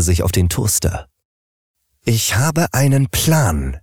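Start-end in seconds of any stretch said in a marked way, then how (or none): 2.0-3.8 slow, weighty announcement. Acted. Fridge announces plan.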